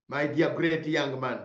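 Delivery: ordinary delivery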